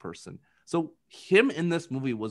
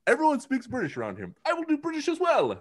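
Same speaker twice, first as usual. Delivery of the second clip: put-on hammy British accent, much higher; mock British accent; 12 semitones higher than usual. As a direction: parody a posh British lilt, pitched way up. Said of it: "she was like".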